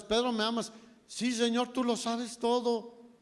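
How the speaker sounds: emphatic acted question and answer; insistent exchange. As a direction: press the point, voice lifted. Third asking, acted with more intensity.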